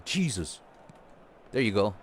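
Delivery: ordinary talk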